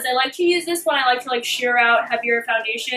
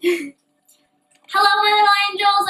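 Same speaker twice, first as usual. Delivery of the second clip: channel intro; 8 semitones higher, 3 dB louder, slower than usual. Shown as a demonstration. YouTube intro greeting.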